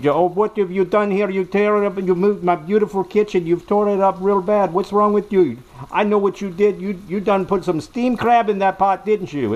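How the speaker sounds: wife chewing out her husband; scolding wife. Retold or imitated. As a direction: tear into him like a furious wife.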